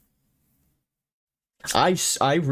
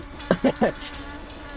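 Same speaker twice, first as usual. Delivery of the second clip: high-pitched helpless giggle; high laugh bursting out; real laughter; 8 semitones higher, 3 dB quieter than usual. Real, not acted. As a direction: crack up at your own attempt. Cracks up at own attempt.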